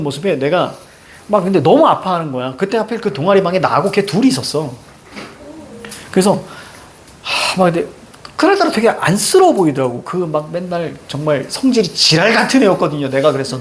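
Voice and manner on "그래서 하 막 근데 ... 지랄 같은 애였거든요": ordinary talk, nothing marked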